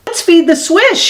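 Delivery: put-on high, bright little boy voice; childlike voice. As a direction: play young Freddie pointing excitedly. Freddie voiced to mother.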